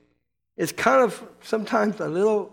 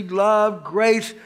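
ordinary delivery

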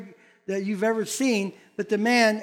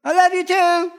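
granddaughter answering; 7 semitones higher, 6 dB louder than usual. Retold or imitated. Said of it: "granddaughter voiced in story".

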